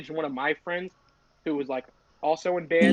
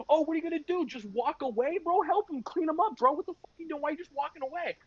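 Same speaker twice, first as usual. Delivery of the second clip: retold shouting; 11 semitones higher than usual. Band friends to the one walking away, mock shouting. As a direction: imitate an indignant crowd piling on. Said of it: "they all start shouting like".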